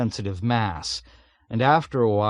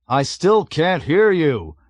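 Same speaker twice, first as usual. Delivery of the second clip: higher, louder, slow calling-back voice; innocent calling back; 6 semitones higher, 6 dB louder, slower than usual. A narrator's reading. Navigator calls back innocently over engine noise. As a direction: call back mildly puzzled over the noise. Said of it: "Arfi called back innocently".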